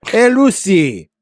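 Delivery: loud, high, drawn-out hammed-up Cuban accent; Ricky Ricardo. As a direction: call out in an exaggerated Cuban accent. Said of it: "like, Ricky Ricardo".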